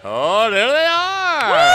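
high, slow delighted call; excited shout. Real, not acted. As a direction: spot them and shout. Marchers arrive at the parade.

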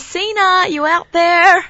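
high, loud call to someone far off. Real, not acted. Calling out to a listener.

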